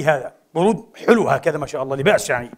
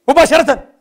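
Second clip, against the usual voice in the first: loud, high "مباشرة" thrown out; one loud word of urgency; emphatic shout; 7 semitones higher, 6 dB louder than usual. Real, not acted. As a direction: hit "مباشرة" hard and high. Stressing immediacy.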